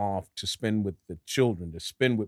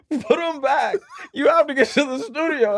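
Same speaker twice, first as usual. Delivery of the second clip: laughing insistence; 6 dB louder than usual. Retold or imitated. Agent voiced laughing, insistent.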